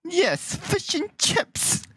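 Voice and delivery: high, hammed-up British accent; bad British accent. Showing off to friends, high badly faked British accent. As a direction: squeak out a slow fake British accent. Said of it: "American trying to impersonate a British guy".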